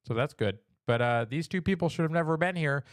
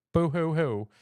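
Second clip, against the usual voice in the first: sarcastic fake sobbing boo hoo; mock boo hoo; 2 semitones higher, 4 dB louder than usual. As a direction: sneer a fake cry. Mocking the complainers' crying.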